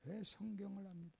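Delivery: barely voiced, low question; very soft acted reproach; gentle rebuke. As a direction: let the reproach drop to a near hush. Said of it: "이렇게".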